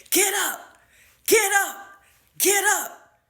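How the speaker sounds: high, loud mock yell of get up; imitated yelling. Teacher screaming at a scared student, high mock yell. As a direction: shriek it like an angry teacher. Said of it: "She was yelling at her like that".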